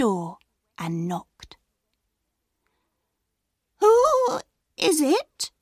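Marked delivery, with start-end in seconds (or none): 3.8-5.5 very high, slow quavering granny; old grandma voice. A narrator's reading. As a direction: call out like a frail old grandma.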